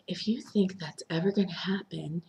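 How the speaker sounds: quietly menacing quote. Retold or imitated. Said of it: "Marlene is like".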